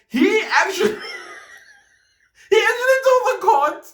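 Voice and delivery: high, fast laughing yell; laughing shout. Real, not acted. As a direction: burst out laughing while shouting. Laughing shout mid-story.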